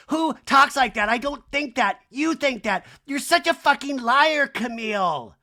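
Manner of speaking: high, loud mock outrage; hammed-up shouting match accusation; Kyle. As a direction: fire back shrilly, as an impression. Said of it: "where Kyle's like".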